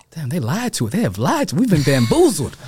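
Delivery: like finding out you've been cheated all along; shocked disbelief. Retold or imitated. Young man realizing a book exposed the lie, laughing in shock. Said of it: "my mind was just like".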